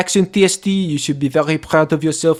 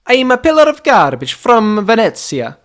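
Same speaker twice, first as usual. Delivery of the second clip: higher, louder hammed-up Italian accent; mock Italian accent; 7 semitones higher, 6 dB louder than usual. Host signs off the podcast in a hammed-up Italian accent. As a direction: sign off like a comic Venetian.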